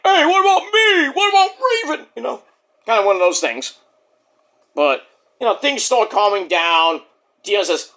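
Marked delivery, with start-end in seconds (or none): 0.0-2.1 higher put-on voice complaining; mock wrestler complaint. Saquon Sugars complains about Dion's title shot, higher put-on voice. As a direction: whine it in character. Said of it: "He's like".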